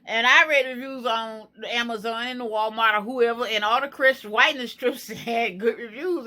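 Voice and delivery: normal talking voice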